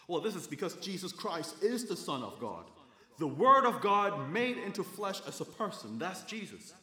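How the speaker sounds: loud, high-pitched preacher's delivery; emphatic preaching. Real, not acted. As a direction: drive the promise home to the congregation, loud and high.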